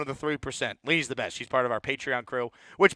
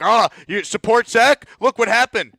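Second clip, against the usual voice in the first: higher, louder, slow sneering mimicry; mocking the first caller; 6 semitones higher, 8 dB louder than usual. Host mimicking the first caller who attacked Jet fans, higher and louder mockery. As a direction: throw his gotcha back in a mocking voice. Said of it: "being like".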